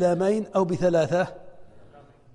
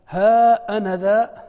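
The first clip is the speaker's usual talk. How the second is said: drawn-out example phrase; 3 semitones higher, 8 dB louder, much slower than usual. Shown as a demonstration. Teacher reads example phrase to students, slow and louder. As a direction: say ها أنا ذا slowly and louder, syllables held.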